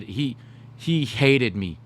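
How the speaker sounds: ordinary talk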